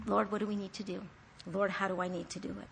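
soft, low appeal to the Lord; hushed asking for guidance; humble prayer. Acted. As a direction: drop to a quiet, submissive prayer.

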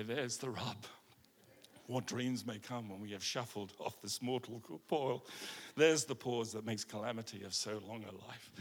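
low, soft, theatrical recitation; Hamlet soliloquy. Acted. Preacher reciting Hamlet to the church, low and dramatic. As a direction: brood over each phrase like Hamlet.

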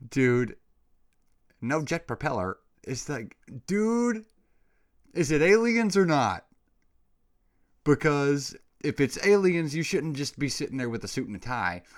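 shouting at the screen in disbelief; exasperated rant. Real, not acted. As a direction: rant at them, fed up and loud. Ranting at the calm government.

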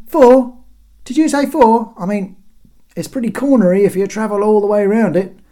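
high, loud quibbling; incredulous heckle. A narrator's reading. Guard querying the herald's four corners, loud heckling. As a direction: butt in from the wall.

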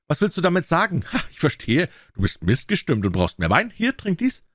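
high-pitched, agitated royal badgering; angry tyrant. A narrator's reading. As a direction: press him in anger, jumping high on the order. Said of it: "schrie der Tyrann wütend".

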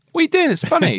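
high, mocking dismissive voice; Nick impression. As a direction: laugh off her tears, mocking. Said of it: "then he says".

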